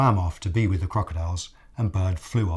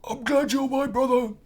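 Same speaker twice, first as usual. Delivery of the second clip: high fond voice; crocodile character voice. Acted. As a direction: say it fondly, high. Crocodile to Bird.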